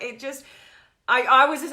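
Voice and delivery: normal talking voice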